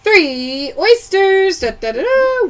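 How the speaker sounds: high, drawn-out singsong reveal; playful prize call. Real, not acted. Host reveals three oysters won, playful. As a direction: draw out the prize, add a fanfare.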